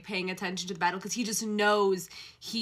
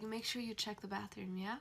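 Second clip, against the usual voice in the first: soft murmur; 11 dB quieter than usual. Acted. Male lead calling to heroine, soft murmur. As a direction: murmur it protectively.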